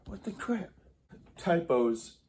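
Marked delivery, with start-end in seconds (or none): 0.1-0.7 hushed annoyed whisper; irritated whisper. Real, not acted. To himself as his phone buzzes, irritated whisper. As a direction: mutter it under your breath, irritated.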